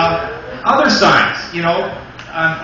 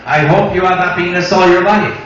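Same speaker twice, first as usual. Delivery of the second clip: put-on louder French Canadian accent pilot; 4 dB louder than usual. Comic imitating a French Canadian pilot, hammed-up French Canadian accent. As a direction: ham up the French Canadian captain. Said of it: "Speaking about accents".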